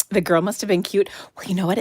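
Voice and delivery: ordinary delivery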